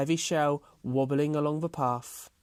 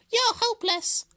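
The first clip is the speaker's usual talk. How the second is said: very high, loud hare voice; high-pitched dismissive squeal; scornful hare; 5 dB louder than usual. A narrator's reading.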